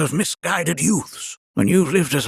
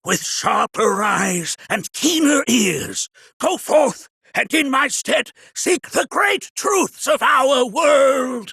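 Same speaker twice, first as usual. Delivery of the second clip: rousing send-off; 10 semitones higher than usual. Acted. Elder sending Nanaki forth on his journey, rousing. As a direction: build it into a blessing.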